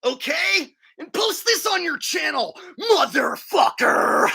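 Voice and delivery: high shouted challenge, dragging out the last word; aggressive taunt. Real, not acted. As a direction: yell it as a dare to a rival. Taunting rival to post it.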